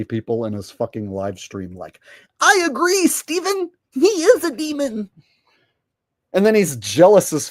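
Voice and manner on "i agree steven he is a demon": high, loud mocking voice; squeaky agreeing fanboy. Mocking viewers in chat.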